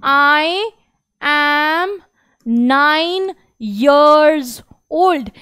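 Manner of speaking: Quel âge as-tu broken into slow separate words; slow syllable drill. Shown as a demonstration. Teacher slowly breaking down the question for kids. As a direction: drill it word by word for the class. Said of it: "slow word drill".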